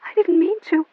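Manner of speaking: high, thin and shaken; weakly. A narrator's reading. Melanie faltering to Maria after the shooting. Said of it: "Melanie began weakly".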